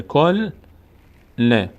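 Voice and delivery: "کل" closed on a held l, pause, then "ل" with its own vowel; read twice, split. Shown as a demonstration. Teacher states the tashdid rule, sounding syllables apart. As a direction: join k to l in "کل", then restart on "ل". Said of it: "یک بار با حرف ما قبل".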